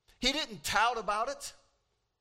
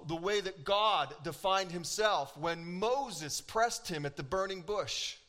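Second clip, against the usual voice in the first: ordinary talk, nothing marked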